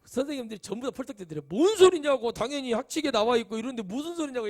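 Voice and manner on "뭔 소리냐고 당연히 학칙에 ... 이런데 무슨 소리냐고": louder, higher indignant retort; indignant teachers. Retold or imitated. Preacher voices teachers scandalized by uniform question, loud retort.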